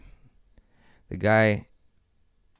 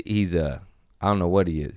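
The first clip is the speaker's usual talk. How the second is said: ordinary delivery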